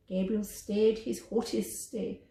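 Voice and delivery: plain reading voice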